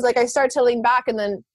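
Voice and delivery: normal talking voice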